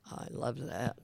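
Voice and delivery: usual speaking voice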